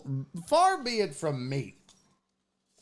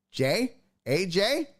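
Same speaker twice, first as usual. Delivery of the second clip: normal talking voice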